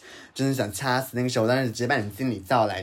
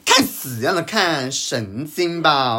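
louder and slow, spitting out 神经吧; slow, loud contempt; furious scoff; 4 semitones higher, 7 dB louder, much slower than usual. Retold or imitated. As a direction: drag it out in disgust. Furious recollection of the stare.

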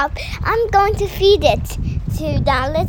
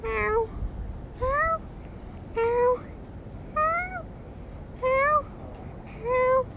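soft cat meows; 6 semitones higher, 7 dB quieter, slower than usual. Acted. Child keeps meowing.

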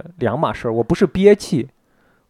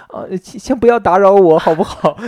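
gentle coaxing voice to a kitten, higher and louder; 哄小猫; 7 semitones higher, 6 dB louder than usual. Retold or imitated. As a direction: coo it like talking to a pet. 对小猫说话. (轻声哄小猫)

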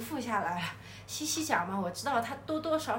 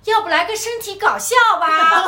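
高调大声、带着笑地提议; 笑着隔远喊出点子; 远远喊话; 9 semitones higher, 15 dB louder than usual. Retold or imitated. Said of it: "说". (学凯子大声笑着出主意)

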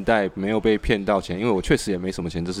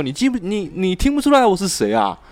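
a light imitation of a Northeastern caller, pitched higher; Northeastern accent; 9 semitones higher than usual. Scam caller teasing on the phone, lightly mocked Northeastern accent. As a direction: play the scam caller from Northeast China, half-mocking. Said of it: "他就用东北腔调跟我讲说".

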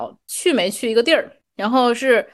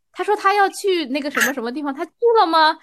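higher-pitched, sweetly gentle question; 6 semitones higher than usual. Shown as a demonstration. Rereading a misdirected message to show a kind reading, gentle tone. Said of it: "他要是用一种温柔的语气说".

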